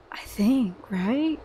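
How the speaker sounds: whisper. Real, not acted. Doubtful whispered aside.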